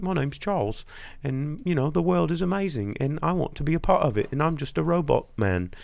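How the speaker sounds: high, bright, naive robot voice; chirpy robot friend. Retold or imitated. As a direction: play a wide-eyed newborn robot. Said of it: "it's like".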